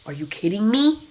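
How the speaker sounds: usual speaking voice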